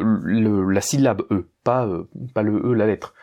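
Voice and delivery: ordinary talk, nothing marked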